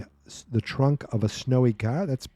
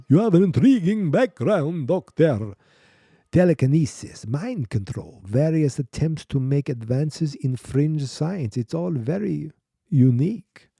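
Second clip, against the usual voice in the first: Dracula; 5 semitones higher than usual. Acted. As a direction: ham it up like a bad vampire count. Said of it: "I'm gonna do this like Dracula almost".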